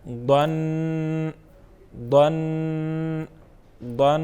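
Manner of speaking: غن with nasal ending, held; nunation demo. Shown as a demonstration. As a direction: sound غن with a clear nasal n. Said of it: "کیا بنا؟". (غن کی آواز ناک سے ادا کریں)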